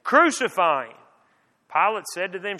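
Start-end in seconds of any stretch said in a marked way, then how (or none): 0.0-1.0 loud, raised cry of a crowd. A narrator's reading. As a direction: narrate an insistent mob calling out. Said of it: "But they cried out".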